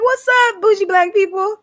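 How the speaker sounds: very high, playful call-out; acted greeting. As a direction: sing out a hello to your crowd. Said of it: "I'm trying to be like".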